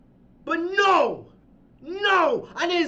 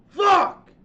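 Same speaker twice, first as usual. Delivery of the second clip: higher, louder exasperated outburst; frustrated curse; 5 semitones higher, 6 dB louder than usual. Real, not acted. Fed up with the booking, a loud frustrated curse. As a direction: let it burst out in disgust. Fed-up ranter swearing alone.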